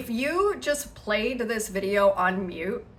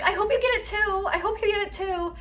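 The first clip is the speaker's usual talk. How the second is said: put-on little girl voice; 11 semitones higher, much faster than usual. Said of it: "The little girl in the back is like".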